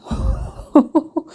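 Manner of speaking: breathy burst of laughter; real amused laugh. Real, not acted. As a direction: laugh before telling a funny story. Laughs at her own story.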